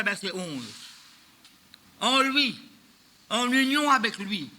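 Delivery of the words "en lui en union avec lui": solemn scripture reading. Acted. Reading the verse aloud.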